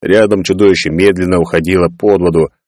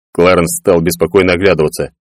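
ordinary delivery, nothing marked

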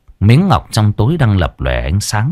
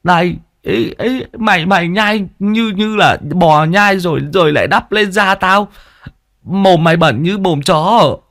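much higher, petulant whine; squeaky whiny boy; 10 semitones higher than usual. A narrator's reading. Sidekick complaining about chewed leaves, high whiny voice. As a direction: gripe like a spoiled kid.

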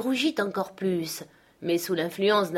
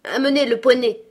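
higher, loud imperious order; commanding; 6 semitones higher, 8 dB louder than usual. A narrator's reading. Girl orders the servant woman, commanding. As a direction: command it sharply as the young mistress. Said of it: "commanda-t-elle".

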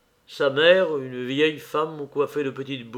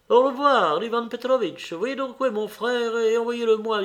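high, raised voice with brisk courtesy; lively lady saying goodbye; 9 semitones higher than usual. A narrator's reading. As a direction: send an acquaintance off on an errand. (dame enjouée, voix haute)

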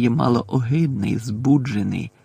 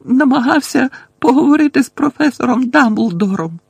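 high, loud wailing delivery; high-pitched distressed wail; tearful confession; 11 semitones higher, 8 dB louder than usual. A narrator's reading. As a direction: blurt it out as if sobbing. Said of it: "схлипнула в долоні Герміона".

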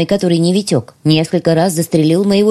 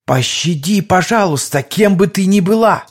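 desperate begging, slowing at the end; pleading thug. Acted. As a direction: beg for your life. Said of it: "взмолился". (мольба о пощаде)

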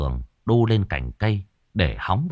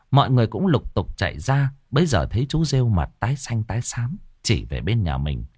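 usual speaking voice

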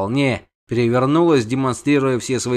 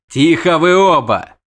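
loud, higher snapped order; angry shout; 5 semitones higher, 6 dB louder than usual. A narrator's reading. Witcher silences the quarrelling pair, angry. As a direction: cut them off angrily. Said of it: "зло крикнул Геральт".

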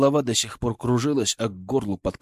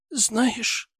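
put-on high girl voice; 11 semitones higher than usual. Daughter voiced.

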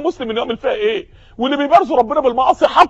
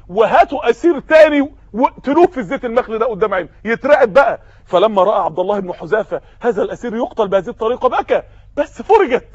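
usual speaking voice

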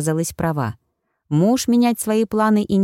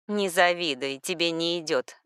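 отмахиваясь; 2 semitones lower, 8 dB quieter than usual. A narrator's reading. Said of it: "отмахнулась Ирина".

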